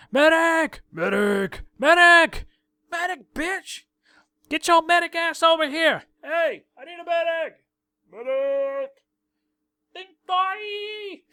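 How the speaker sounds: frantic high battlefield yell for a medic; man down shout. Retold or imitated. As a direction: scream for a medic under fire.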